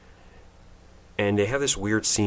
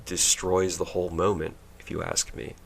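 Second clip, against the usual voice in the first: ordinary talk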